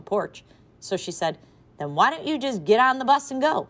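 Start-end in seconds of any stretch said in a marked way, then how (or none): 1.8-3.7 higher, louder bold call. A narrator's reading. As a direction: dare them from the porch. Maxine calling out safely.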